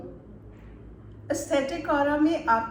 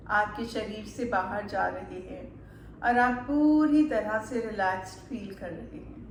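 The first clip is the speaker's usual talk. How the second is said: calm meditation guide, unhurried with long pauses; measured guided-relaxation voice, lingering on soothing words; 3 semitones lower, 4 dB quieter than usual. Acted. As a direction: ease the listener into relaxation, phrase by phrase.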